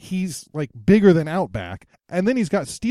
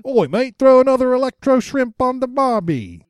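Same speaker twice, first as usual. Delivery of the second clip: high, broad mock Australian; hammed-up cartoon Aussie accent; Outback's Australian accent; 7 semitones higher, 4 dB louder than usual. Showing cohost how Outback talks, mock Australian accent. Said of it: "how exactly does he talk, [name]?".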